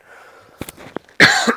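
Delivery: harsh cough from a cold; loud cough; coughing fit. Real, not acted. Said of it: "Sorry, got a cold".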